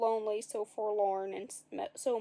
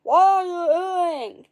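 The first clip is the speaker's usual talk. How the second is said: slow, high, loud, mangled words; garbled yell with a held tongue; 7 semitones higher, 12 dB louder, much slower than usual. A narrator's reading. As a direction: talk with someone gripping your tongue. Tongue held, Jack talks.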